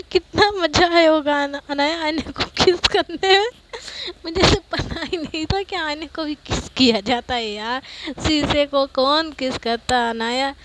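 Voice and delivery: loud teasing. Acted. Friends teasing about kissing mirror.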